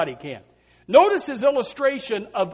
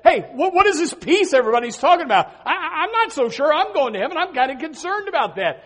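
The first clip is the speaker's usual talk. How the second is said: lightly voiced anxious teen, higher and quick; a hint of a fretful youth, fast and higher; worried young people; 7 semitones higher, 3 dB louder, faster than usual. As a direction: mimic a nervous kid asking about heaven. Voicing worried youths at conference.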